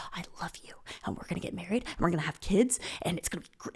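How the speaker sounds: hushed, breath-only confession; creepy whisper. Acted. Crazed roommate holding her down, intense whisper. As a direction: whisper it right into their face. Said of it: "right up against my face".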